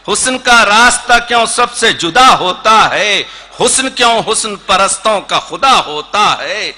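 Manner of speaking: rhythmic, lilting declamation of verse; Urdu poetry recitation. Acted. Poet presents a poem on absolute beauty, declaimed. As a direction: recite with building emphasis on each refrain.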